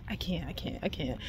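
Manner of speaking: breath giving out in a whisper; too fed up to speak above a whisper; real frustration collapsing into a whisper; exasperated whisper. Real, not acted.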